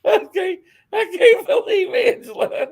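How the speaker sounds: very high, laughing squeal; words squeaked out mid-laugh; cracking up. Real, not acted. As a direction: speak through helpless laughter. Cracking up over Angela's remark.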